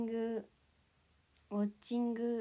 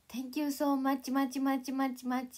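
slow, higher, louder much much much; mock gushing thanks; 4 semitones higher, 5 dB louder, much slower than usual. Imagining an English thank you, mock gushing. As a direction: pile on the much to overdo it. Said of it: "って言えばさ".

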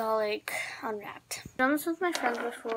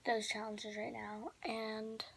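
whispering; 9 dB quieter, slower than usual. Real, not acted. Nighttime, keeping quiet.

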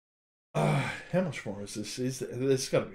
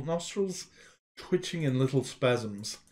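plain reading voice

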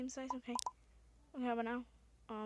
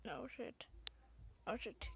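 tense whispered curse; whispered alarm; 10 dB quieter than usual. Real, not acted. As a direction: breathe it out in alarm. Danger in game.

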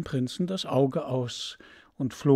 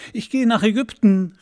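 higher, louder call; the bird calls out proudly; 7 semitones higher, 8 dB louder than usual. Acted. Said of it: "rief der Vogel". (laut und höher rufend)

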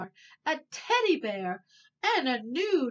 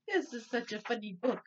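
usual speaking voice

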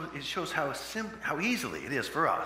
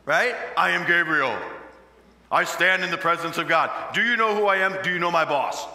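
loud, higher, indignant angel: do you know who I am; 5 semitones higher, 9 dB louder than usual. Acted. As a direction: thunder it as an offended angel. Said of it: "Zechariah gets".

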